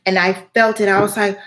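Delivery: ordinary talk, nothing marked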